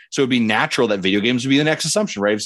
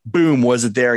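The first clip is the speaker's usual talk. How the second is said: normal talking voice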